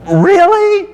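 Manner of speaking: sharp high incredulous really. Acted. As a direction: scoff in disbelief at the pretence. Mocking churchy pretence.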